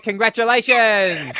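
high, long-held game-show cheer; drawn-out congratulations. Real, not acted. Radio host handing winner her prize, drawn-out cheer. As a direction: stretch the word out in celebration.

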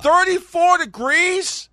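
high, loud, drawn-out disbelief; incredulous. Real, not acted. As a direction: squeal it slowly as an astonished question. Incredulous over the cold temperature.

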